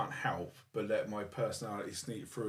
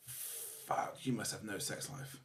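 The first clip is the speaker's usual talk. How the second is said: whispered; 3 semitones lower, 7 dB quieter, much slower than usual. Retold or imitated. Thinking it in a meeting.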